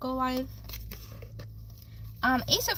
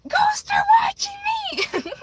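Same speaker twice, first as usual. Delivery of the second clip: high, loud gleeful yell; excited shout; 4 dB louder, slower than usual. Retold or imitated. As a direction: cheer it out like a kid. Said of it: "I'm like".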